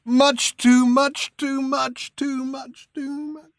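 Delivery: slow, fading moan. Acted. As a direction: let it die away in a moan. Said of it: "moaning quietly".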